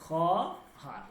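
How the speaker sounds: খহার split as "khaw... har", no w sound; silent waw. Shown as a demonstration. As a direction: sound out খহার slowly in two syllables. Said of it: "ওকে, এইভাবে হবে খহার". (খহার, ওয়াও ছাড়া খ-হার ভেঙে উচ্চারণ)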